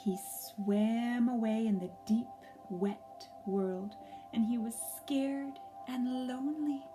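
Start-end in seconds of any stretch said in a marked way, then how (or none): none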